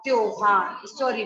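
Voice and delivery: usual speaking voice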